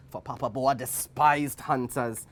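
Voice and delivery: usual speaking voice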